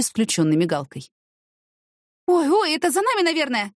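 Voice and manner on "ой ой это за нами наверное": high-pitched, eager outcry; excited exclamation. A narrator's reading. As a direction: burst out with sudden hope. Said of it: "воскликнула Яна". (взволнованный возглас)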